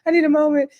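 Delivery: high, teary mock plea; mock crying. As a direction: imitate her sniffling for a break, half-joking. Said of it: "She's crying. She's like".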